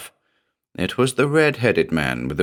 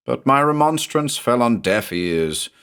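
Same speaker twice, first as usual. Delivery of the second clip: ordinary delivery, nothing marked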